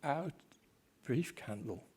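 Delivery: slow, hushed, grieving soliloquy; Shakespeare recitation. Acted. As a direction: let each phrase fall into emptiness. Macbeth's tomorrow soliloquy recited.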